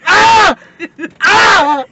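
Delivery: high, loud cry of burning pain; pained yell. Real, not acted. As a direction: scream out the heat. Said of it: "I can't".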